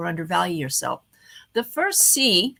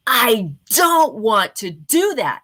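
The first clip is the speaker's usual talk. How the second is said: louder, slow and punchy with energy; upbeat, energetic; 7 dB louder, slower than usual. Shown as a demonstration. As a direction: sell it with upbeat oomph. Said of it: "I tend to prefer the statement of".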